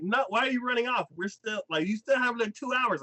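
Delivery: high-pitched indignant objection; mock exasperated complaint; retold protest. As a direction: act out her protest, pitched well up. Retold protest at his running off.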